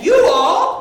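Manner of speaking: loud rising call of "You all"; cue call. Shown as a demonstration. Host teaching the audience its cue, a loud rising call. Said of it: "If you ever hear me say".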